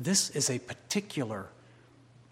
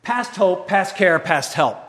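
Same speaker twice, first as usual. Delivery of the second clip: Past hope, past care, past help cried loud and higher; loud cry; 6 semitones higher, 11 dB louder than usual. Acted. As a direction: shout the three phrases to God. Loud version.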